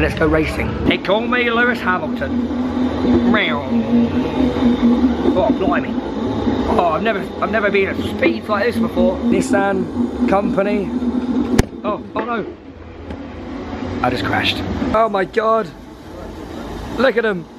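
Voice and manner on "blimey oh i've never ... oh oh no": normal talking voice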